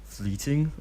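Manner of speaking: questioning rising repeat; puzzled echo. A narrator's reading. Obito echoing the kid's word, puzzled. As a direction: echo the word back, unsure. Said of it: "Obito echoes".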